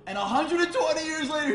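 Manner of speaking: high, loud, stretched; a rabbi preaching the hundred and twenty years, loud and high; fervent preaching. Acted. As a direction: build it up like a sermon. Preached retelling of Noah.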